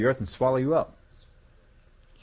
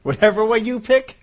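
breezy, unbothered God voice rising to loud, laughing by the end; preacher voicing a carefree God to the congregation; God speaking, offhand; 4 semitones higher, 3 dB louder than usual. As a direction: play God shrugging it off, chuckling. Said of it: "God said".

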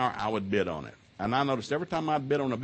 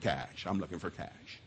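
blunt low answer; 7 semitones lower, 11 dB quieter, slower than usual. Retold or imitated.

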